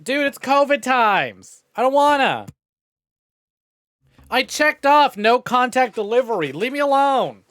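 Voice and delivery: high, loud whining refusal; high petulant whine; whiny brat voice. Pretend. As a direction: whine like a kid refusing chores.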